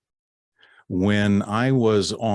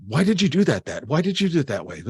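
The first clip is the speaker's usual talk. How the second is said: mock angry fan; 8 semitones higher, faster than usual. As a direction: scold him like an obsessed fan. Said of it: "read him the riot act".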